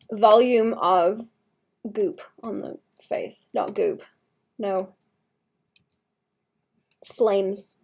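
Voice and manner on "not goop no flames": ordinary delivery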